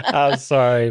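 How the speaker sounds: high, loud, through real laughter; laughing apology. Real, not acted. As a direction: apologize while cracking up laughing.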